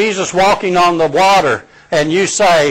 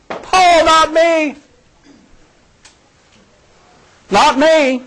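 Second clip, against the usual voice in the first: much higher, dismissive not me; boastful Peter; 12 semitones higher, 3 dB louder, slower than usual. Retold or imitated. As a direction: brush it off proudly.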